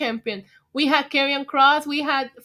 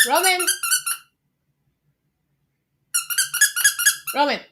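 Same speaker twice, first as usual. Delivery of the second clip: calling a dog. Real, not acted. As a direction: call the pet from across the house.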